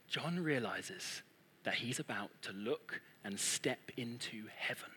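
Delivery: breath-held storytelling, very soft; hushed suspense. Retold or imitated. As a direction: drop almost to a whisper in awe. Hushed suspense before entering heaven.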